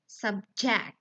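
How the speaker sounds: "subject" with the stress on JECT; stress on second syllable. Shown as a demonstration. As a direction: verb form "subject", subJECT. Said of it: "has stress on the final syllable".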